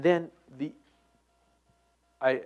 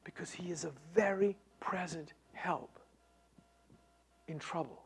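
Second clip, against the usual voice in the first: whispered; 10 dB quieter than usual. Shown as a demonstration. Verse first read as a whisper.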